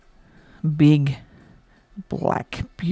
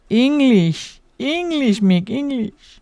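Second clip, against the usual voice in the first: higher, louder mock plea for English; mock pleading; 6 semitones higher, 4 dB louder, slower than usual. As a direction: beg for English, pitched up and hammed. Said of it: "Is it".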